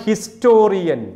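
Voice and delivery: ഹിസ്റ്റോറിയൻ slowly, with an audible H; sounded H. Shown as a demonstration. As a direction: demonstrate the spoken H opening ഹിസ്റ്റോറിയൻ. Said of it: "ഇങ്ങനെയൊക്കെ പറയുമ്പോൾ ഹ". (ഹിസ്റ്റോറിയൻ, 'ഹ' വ്യക്തമായി ഉച്ചരിച്ച്)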